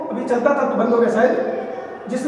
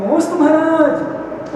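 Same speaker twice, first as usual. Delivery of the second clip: higher, drawn-out mock salutation; devotee's salutation; 4 semitones higher, slower than usual. Monk acting a devotee greeting a maharaj, drawn-out salutation. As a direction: stretch out the greeting, pitched up.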